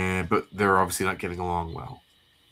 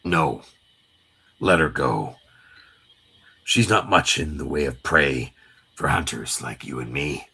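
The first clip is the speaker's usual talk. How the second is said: very low, measured command; stern comic-book tough guy; 8 semitones lower than usual. Acted. Wolverine telling Gambit to let her go, low warning. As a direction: drop the voice deep and hold firm. Acting Wolverine defending Jubilee.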